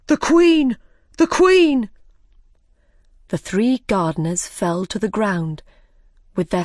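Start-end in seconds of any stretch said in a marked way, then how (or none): 0.1-1.9 high, loud warning cry; alarmed shout. Acted. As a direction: yell the warning as she comes. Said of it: "Five shouted suddenly".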